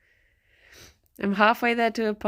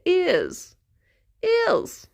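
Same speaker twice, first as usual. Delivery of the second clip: high, falling posh "yes"; "ears" pitched high as a hyper-posh yes; hyper posh accent; 9 semitones higher than usual. Shown as a demonstration. Streamer showing the trick for posh, hyper-posh accent. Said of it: "saying yes in a posh accent".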